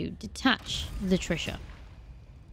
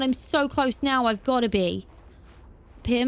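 ordinary delivery

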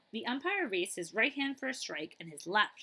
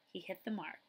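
ordinary delivery

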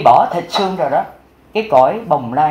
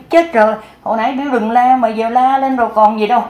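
higher, urgent cry of alarm; alarmed exclamation; 6 semitones higher than usual. Retold or imitated. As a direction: blurt it out in sudden dismay. Old man alarmed at the shouting. (hốt hoảng kêu lên, giọng cao)

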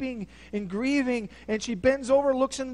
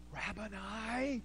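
much softer and slow, reverent; awed tender Rabboni; 11 dB quieter, slower than usual. Acted. Mary recognizing her teacher, soft and reverent. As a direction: breathe it in awe.

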